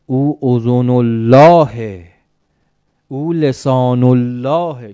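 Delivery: very slow, loud reverent declaiming; solemn proclamation. Acted. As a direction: draw out each holy title with mounting force. Acted solemn praise of the Imam. (اعلام پرطنین و کشیدهٔ القاب مقدس)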